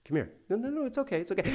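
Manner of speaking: soft, hushed mock coaxing; quiet coaxing parent. Parent coaxing a child before the yelling, mock quiet. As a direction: imitate a parent luring a child closer quietly. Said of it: "kind of be quiet and quiet".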